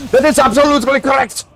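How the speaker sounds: high, quick put-on voice; mock-pompous voice. Streamer humouring a viewer's superior hearing, mock-pompous high voice. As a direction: burst into a high, hurried affirmation.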